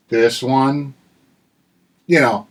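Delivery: ordinary delivery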